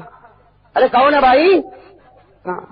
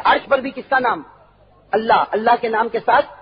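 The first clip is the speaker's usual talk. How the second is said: usual speaking voice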